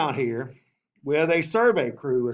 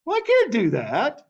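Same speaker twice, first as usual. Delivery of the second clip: mock refusal; 6 semitones higher, 4 dB louder than usual. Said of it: "governor's like".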